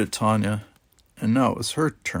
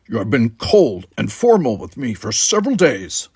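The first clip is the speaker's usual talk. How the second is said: louder, stern accusation; Worf accusing; 7 dB louder than usual. Acted. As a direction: lay out the grievance.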